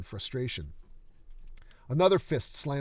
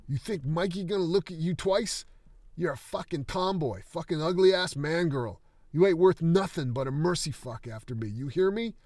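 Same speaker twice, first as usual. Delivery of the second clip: higher, much louder hateful rant; furious screaming; 7 semitones higher, 7 dB louder than usual. Acted. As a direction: spit the insults at her. Wounded coach screaming insults.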